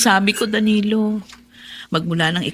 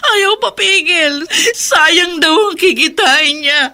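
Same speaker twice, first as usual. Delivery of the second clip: slow, higher, louder crying words; sobbing; 7 semitones higher, 6 dB louder, slower than usual. Acted. Wife crying to her father about her husband, sobbing. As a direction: sob it out, blaming herself.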